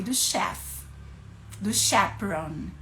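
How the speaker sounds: normal talking voice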